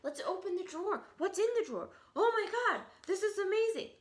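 high-pitched, excited parrot voice; hyper parrot. Acted. A parrot exploring everything in the house, hyper and excited. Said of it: "He's just hyper".

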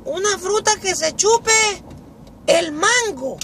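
very high put-on pupil voice; schoolboy answering. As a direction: answer the teacher in a squeaky voice. Carlito piensa y responde. (voz muy aguda de alumno)

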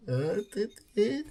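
sudden high squeak, pleased with himself; squeaky gloating. Real, not acted. Streamer to viewers after recalling the name, squeaking proudly. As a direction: crow in a squeaky voice at your own trick. Pleased with his own cleverness.

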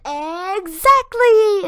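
high, loud, very slow drawn-out exclaim; imitating a punster. Imitating Brooke delivering her pun, high loud slow exclaim. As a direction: stretch it out high and loud like a proud punchline. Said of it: "she said".

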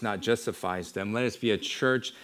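usual speaking voice

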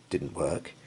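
ordinary delivery

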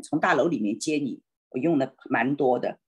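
ordinary talk, nothing marked

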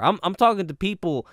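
ordinary delivery

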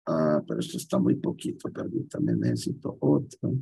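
low, soft muttering to herself, half whispered; talking under her breath while hunting for a file; muttered aside. Real, not acted. Teacher mutters to herself while searching her files, low and soft.

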